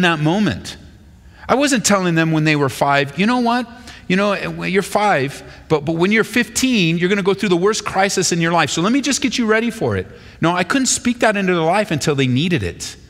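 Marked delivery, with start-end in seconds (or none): 3.2-10.1 put-on lecturing a child. Pastor voicing himself lecturing kids.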